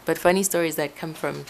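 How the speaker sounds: ordinary talk, nothing marked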